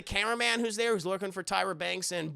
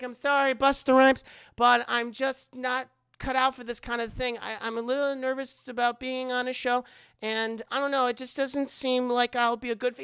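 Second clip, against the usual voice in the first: put-on nervous young woman; 6 semitones higher, 3 dB louder than usual. Nervous Sarah quoted.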